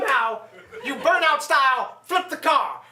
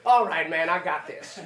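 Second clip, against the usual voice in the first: much lower, mumbled dopey Quinn voice; mock Quinn; 9 semitones lower than usual. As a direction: drop low and lazy like a cocky dimwit. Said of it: "And you say".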